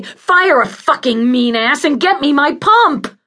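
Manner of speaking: high, loud venomous tirade; angry rant. Acted. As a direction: accuse furiously, building to a loud demand. Patient rants against ward head.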